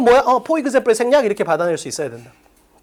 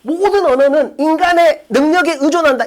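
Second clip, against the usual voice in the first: clueless student reading. Retold or imitated. Teacher mimics a student who only thinks they understood, mock clueless reading. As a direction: imitate a student reading mindlessly. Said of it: "그냥 존나 멍청하게 읽는 거야".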